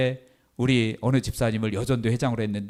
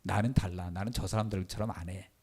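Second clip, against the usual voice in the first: soft, private self-righteous murmur; the preacher voicing his hidden pride, softly; inner voice; 4 semitones lower, 9 dB quieter than usual. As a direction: say it quietly to yourself. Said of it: "남들에게 표현은 안 했지만".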